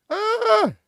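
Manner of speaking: very high, sudden shriek of delight; a squealing yelp of glee. Real, not acted. Streamer shrieks at the image, delighted squeal.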